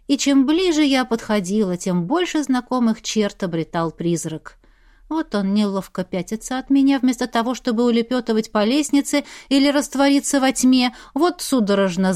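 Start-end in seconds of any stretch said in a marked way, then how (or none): none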